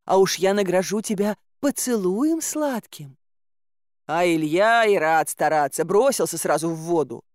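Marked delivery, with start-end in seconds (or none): 0.0-3.2 pleading swamp maiden. Acted. Swamp maiden calls to bogatyrs for help, high pleading. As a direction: coax sweetly in a high maiden's voice.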